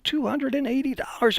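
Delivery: high, indignant mock yell on two; outraged repeat. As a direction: throw the price back in disbelief. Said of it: "yelling and screaming".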